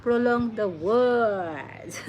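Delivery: slow, with words stretched right out; prolonged words. Shown as a demonstration. Learner showing how the accent prolongs words, very drawn out. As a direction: drag "words" out long. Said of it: "speaking slow and prolong the".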